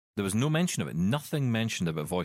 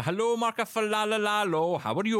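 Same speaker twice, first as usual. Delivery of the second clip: hammed-up posh British greeting, higher; mock posh English accent; 11 semitones higher than usual. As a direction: greet him in a put-on Downton Abbey English accent. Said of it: "I always feel like I have to do that accent".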